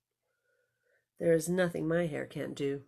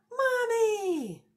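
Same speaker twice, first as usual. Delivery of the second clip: very high, loud call with a laugh; joyful greeting cry; 6 dB louder than usual. Acted. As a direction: call out with delight as she comes in. Girl greets returning mother.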